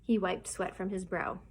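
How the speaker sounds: plain reading voice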